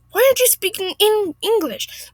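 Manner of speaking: high-pitched, affronted demand; put-on outraged complainer; indignant Karen. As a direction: whine it up high in disbelief. Acted Karen demanding English.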